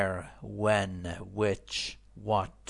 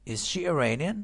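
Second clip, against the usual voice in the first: higher question tune rising on "Iranian"; rising intonation; 5 semitones higher than usual. Shown as a demonstration. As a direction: end "Iranian" on an upward lift. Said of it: "پس تون صدا میره بالا".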